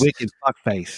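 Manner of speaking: slow, drawn Boston "wicked fuckface"; Boston accent. Retold or imitated. Host chiming in with a mock Boston insult. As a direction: lay on a thick Boston accent.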